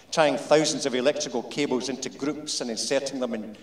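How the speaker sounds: plain reading voice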